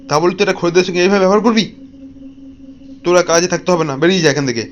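loud, furious telling-off; angry scolding. Acted. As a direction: roar at the worker to get out. Boss scolds and fires shop assistant.

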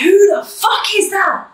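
put-on yelling at a boyfriend caught on camera, shrieking high; screaming. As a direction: scream it, raw disbelief. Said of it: "I'm screaming".

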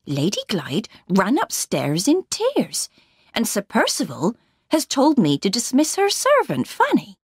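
swooping, sing-song pitch as the housekeeper; anxious housekeeper. Acted. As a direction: report bad news with big rises and falls.

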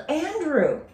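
high called name; yelling. Acted. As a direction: call out to her brother. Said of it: "She yelled".

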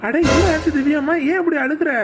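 startled by his daughter's tears; alarmed concern. Acted. Father alarmed at crying daughter.

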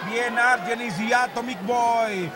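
normal talking voice

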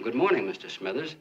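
half-hearted call over to a neighbor; mild retold yell. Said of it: "so I yelled over to him".

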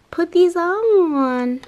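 squealing delight. Real, not acted. Can't wait to wear nails, squealing. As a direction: stretch it into a thrilled squeal. Excited about new nails.

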